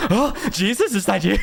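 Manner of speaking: high-pitched, hammed-up alarm; mock startled. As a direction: retell a jolt of shock through laughter. Retold startled reaction to the voice.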